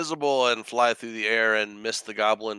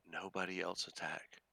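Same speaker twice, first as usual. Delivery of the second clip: whisper; 4 semitones lower, 18 dB quieter than usual. Acted. Conspiratorial GM aside.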